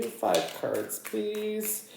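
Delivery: ordinary delivery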